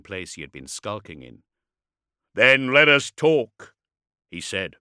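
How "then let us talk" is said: slow, loud warlord voice; projected declaration. A narrator's reading. Warlord agrees to talk with the dreadnought, loudly. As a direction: announce it slowly across open ground.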